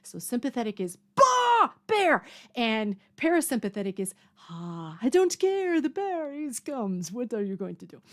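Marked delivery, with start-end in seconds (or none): 1.2-2.2 high, loud startled outburst; mock alarm. Showing what sympathetic is on seeing a bear, in mock alarm. Demonstrates fight-or-flight reaction to bear. 4.5-8.0 put-on Eastern European accent. Showing parasympathetic calm about the bear, in an Eastern European accent. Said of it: "I went Eastern European accent on that one".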